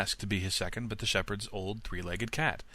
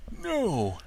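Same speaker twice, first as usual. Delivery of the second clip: higher firm refusal; Sultan refusing; 10 semitones higher than usual. A narrator's reading. Old dog refuses the wolf, higher and firm. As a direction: refuse the wolf flatly, pitched up. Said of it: "said the Sultan".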